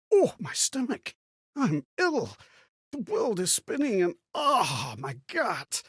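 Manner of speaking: clutch the gut and moan it out; sick and hurting, voice strained high; pained groaning. Acted. Sick from eating strange mushrooms.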